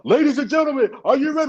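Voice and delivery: high, amped-up show opener; imitated announcer. Announcer starting the show on stage, imitated announcer. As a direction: rev up the crowd. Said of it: "supposed to announce the show".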